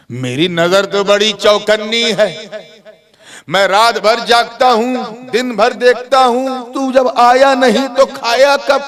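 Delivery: high, insistent challenge; indignant old man. Acted. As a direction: boast of watchfulness, building to a demand. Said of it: "बूढ़े ने कहा". (बूढ़े का ऊँचा, हैरान सवाल)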